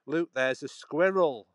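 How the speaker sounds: slow, careful Queen's English. Shown as a demonstration. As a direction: say it in Queen's English, each vowel full. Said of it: "trying to do it Queen's English".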